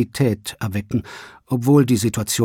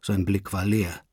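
ordinary delivery

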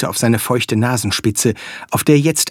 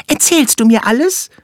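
high, quick little flea voice; squeaky tiny creature; 12 semitones higher, 4 dB louder than usual. Acted. Flea character speaks.

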